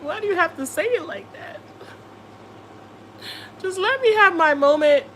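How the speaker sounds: hammed-up plea to be left alone; mock whine. Retelling her own whine to the viewers about shrimp. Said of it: "And I'm like".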